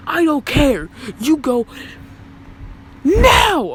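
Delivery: high, loud order rising to a shriek on now; mock yelling teacher. Teacher ordering the boy to the office, yelling. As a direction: yell the kid out of the room.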